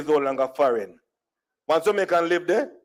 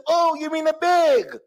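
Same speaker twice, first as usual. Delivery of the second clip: twanged correction; 11 semitones higher than usual. Retold or imitated. Store lady correcting a customer, high twanged "bag" like "beg". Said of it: "I began to twang".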